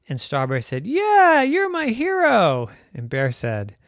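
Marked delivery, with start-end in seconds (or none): 0.9-2.7 high, loud happy cheer; excited. Acted. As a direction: cheer for the hero. Unicorn cheers bear.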